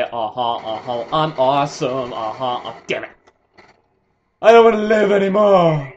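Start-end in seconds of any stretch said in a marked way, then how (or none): 4.4-5.9 hammed-up wail, higher and louder; mock despair. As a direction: overdo the despair. Mock despair after dying.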